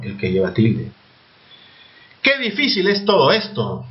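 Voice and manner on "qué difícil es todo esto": "Qué difícil" pitched high, as an exclamation; high exclamatory tune from "Qué", falling to "esto"; exclaiming. Shown as a demonstration. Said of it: "Estoy exclamando".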